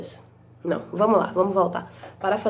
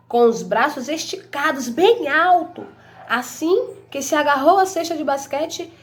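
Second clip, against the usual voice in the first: higher, slow, dramatic storybook telling; storybook reading; 6 semitones higher, 4 dB louder, slower than usual. Acted. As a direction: savour each moment of the story for the kids. Reads the enriched story ending. (leitura dramática de história infantil, lenta e aguda)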